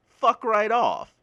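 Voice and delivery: slow, loud, high fed-up blast; angry outburst. Real, not acted. Ranting to the podcast audience, a loud fed-up outburst. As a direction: spit it out, done with the critics. Outburst at people who get mad.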